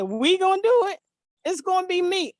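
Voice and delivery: high, loud protest in the son's voice; imitated incredulous son. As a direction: throw it back in startled disbelief. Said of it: "He said".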